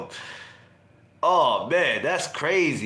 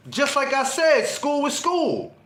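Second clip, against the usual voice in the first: higher, slow drawn-out sarcastic reply; teen attitude; 8 semitones higher, slower than usual. Retold or imitated. Sulky teen mocks his mother's question, slow and sarcastic. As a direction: sass your mother, stretching it out. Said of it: "getting an attitude with your mother".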